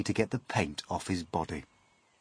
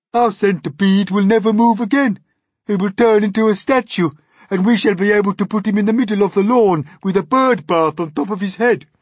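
louder, fast, odd-voiced worm; earthworm's gleeful voice; 12 semitones higher, 11 dB louder than usual. Earthworm describing the centipede statue, fast and loud. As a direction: rattle on with gloomy glee.

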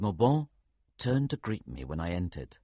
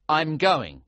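firm announcement; 7 semitones higher, 7 dB louder than usual. A narrator's reading. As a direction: say it with no room for doubt. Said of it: "I announced firmly".

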